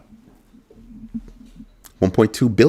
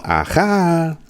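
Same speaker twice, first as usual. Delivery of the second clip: slow, high drawn-out aha; playful movie quote aha; Eddie Murphy aha; 9 semitones higher than usual. Retold or imitated. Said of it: "Eddie Murphy Coming to America".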